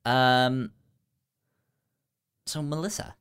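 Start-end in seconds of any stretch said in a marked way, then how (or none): none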